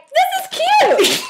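high mock girl voice; imitating a girl. Telling her sister about the girl, high and mocking. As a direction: squeak it out like the girl did. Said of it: "She's like".

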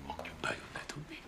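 fast whispered mutter; whispering. Real, not acted. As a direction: whisper the clue to yourself. Muttering crossword clue.

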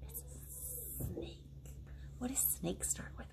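soft unvoiced whisper. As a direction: hiss it like a secret. Snake reveal for kids.

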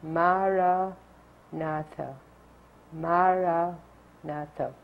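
Maranatha, ma-ra-na-tha, each syllable even, the second take slow; four equal syllables. Shown as a demonstration. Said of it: "We say it in four syllables".

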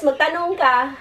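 ordinary talk, nothing marked

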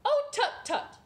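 high, loud tutting cluck; high hen tut-tut. Acted. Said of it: "clucked Dora".

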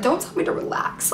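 breathy hushed plea, voice dropped to a whisper; panicked whisper. Retold or imitated. Telling mom not to tell her to relax, in a panicked whisper. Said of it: "I was just like".

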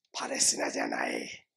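hushed, secretive aside; conspiratorial whisper. Acted. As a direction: whisper it like a secret only insiders know. (秘密めかしたささやき)

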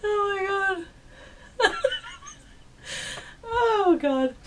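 squeaky, high, laughing through tears. Real, not acted. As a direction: gasp it out mid-laugh. Still laughing at the reply.

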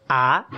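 lighter "ah" rising cleanly upward; corrected rising tone. Shown as a demonstration. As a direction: show the fix: a clean rising "ah". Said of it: "too heavy on the low end".

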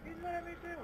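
high panicked repeated cry; panicked repeating. Real, not acted. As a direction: wail it over and over, high.

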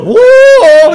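loud, high braying whoa; mule bray. Retold or imitated. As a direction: let out a mule's bray, full voice.

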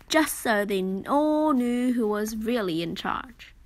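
usual speaking voice

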